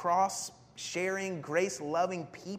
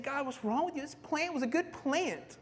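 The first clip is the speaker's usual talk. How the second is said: high, hammed-up whiny protest; angry complaint; 10 semitones higher, 3 dB louder than usual. Preacher playing Jonah angry at the Lord, indignant. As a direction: protest to God like a wronged child. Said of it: "Jonah is just, just angry at the Lord".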